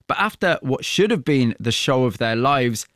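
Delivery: ordinary talk, nothing marked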